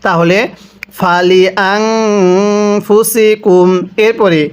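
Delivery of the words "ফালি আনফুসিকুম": ফালি আনফুসিকুম recited slowly, the ন in আনফুসিকুম hummed; nasal ghunnah. Shown as a demonstration. Teacher demonstrating ghunnah to students, slow recitation. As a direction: hum the নুন before ফ in আনফুসিকুম. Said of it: "গুন্নাহ করব".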